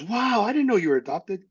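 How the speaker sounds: wide-eyed wonder, as retold; retold surprise. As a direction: quote someone taken aback by news. Retold reaction to adoption news.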